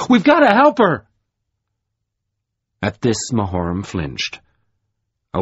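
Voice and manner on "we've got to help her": much higher and louder plea; urgent cry. A narrator's reading. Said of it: "turned to Mahoram and cried".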